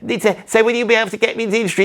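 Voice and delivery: put-on high, rushed girl asking; eager girl. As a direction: gabble the question like a hopeful starlet. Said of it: "And then the girls, like, there".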